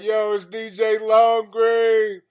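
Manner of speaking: loud, high, drawn-out hype-man DJ shout; mock radio DJ. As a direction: belt out the show intro like an over-the-top DJ. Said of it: "He could be like".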